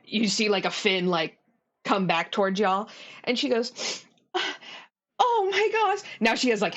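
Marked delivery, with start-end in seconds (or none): 5.2-6.0 high, delighted gasp of words; acted happy astonishment; overcome with joy. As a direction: gush in a raised, girlish pitch. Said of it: "happy tears".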